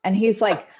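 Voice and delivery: usual speaking voice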